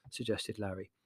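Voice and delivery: ordinary delivery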